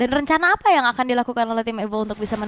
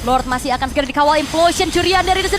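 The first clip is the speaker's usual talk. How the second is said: rising, louder announcer hype; fighting game announcer; 7 semitones higher, 6 dB louder than usual. Real, not acted. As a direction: build up to the big play. Caster builds to a big play.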